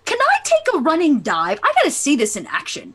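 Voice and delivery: gush, then rush toward the dive; excited marvelling. Acted. Adventurer marvelling at the swimming creature, excited.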